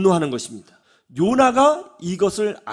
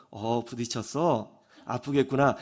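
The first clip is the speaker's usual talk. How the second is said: gentle sympathy; 7 semitones lower, 7 dB quieter than usual. Acted. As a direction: comfort her quietly. Husband calmly sympathizing.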